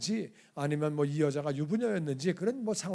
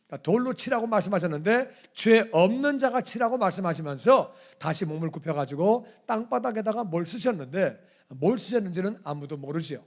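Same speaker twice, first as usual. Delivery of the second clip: normal talking voice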